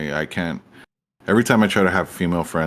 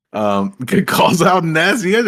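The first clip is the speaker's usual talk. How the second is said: laughing; 9 semitones higher, 5 dB louder than usual. Real, not acted. Laughing reading chat.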